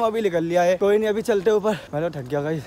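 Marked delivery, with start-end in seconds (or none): 1.9-2.6 out of breath. Real, not acted. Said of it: "सांसें फूल गई".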